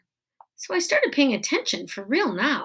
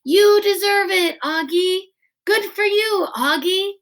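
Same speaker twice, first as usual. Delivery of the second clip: higher, louder called-out praise; yelling praise; 8 semitones higher, 6 dB louder than usual. A narrator's reading. Crowd yelling congratulations to Augie, loud high calls. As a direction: call it out across the room. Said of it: "people yelling things out at me".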